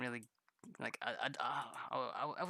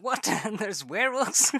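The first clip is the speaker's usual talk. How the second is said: loud, high, laughing disbelief; mock shock; 9 semitones higher, 11 dB louder than usual. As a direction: act out being blindsided by werewolves. Retelling shock at the werewolves.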